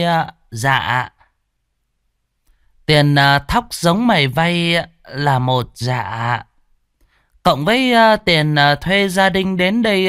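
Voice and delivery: high, drawn-out voice, hemming between sums; tally debts like a smug landlady; greedy mistress. A narrator's reading. Landlady reckoning the tenants' debts, high and smug.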